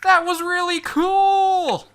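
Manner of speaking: high, slow, loud cheer; loud crowd shout. Acted. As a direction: yell it from the back. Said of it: "that same voice calls out".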